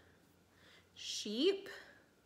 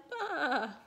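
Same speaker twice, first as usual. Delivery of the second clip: higher, drawn-out bleat; lamb's cry; 4 semitones higher than usual. A narrator's reading. Lost lamb near a hungry wolf, high bleating cry. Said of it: "cried the little lamb".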